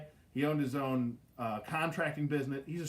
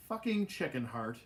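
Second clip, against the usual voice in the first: normal talking voice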